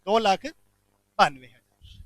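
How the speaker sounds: "दो लाख बानवे हजार" spelled out slowly, word by word, like for notes; teacher slowly repeating the figure "दो लाख बानवे हजार" for the class to note; slow dictation. Shown as a demonstration. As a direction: drag out "दो लाख बानवे हजार" so students can write it.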